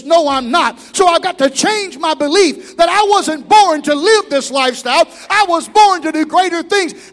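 loud, building sermon cry; preaching at full force. Acted. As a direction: lift the church with it.

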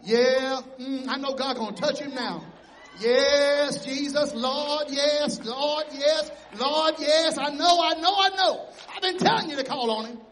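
loud exultant yes Lord; fervent loud amen corner; fervent praise. Retold or imitated. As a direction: cheer him on at the top of your voice. Crowd suddenly cheering Bartimaeus.

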